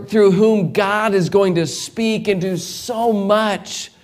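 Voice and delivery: rising pulpit fervor; fervent preaching. Acted. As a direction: preach it out to the congregation. Preaching at full force.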